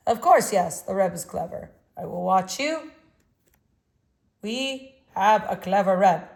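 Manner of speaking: a hint of Russian accent, firm and strong; vague Russian accent. A narrator's reading. Old artist introducing himself to the boy, a touch of Russian accent. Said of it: "he spoke with a vague Russian accent".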